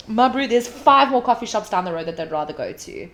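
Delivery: normal talking voice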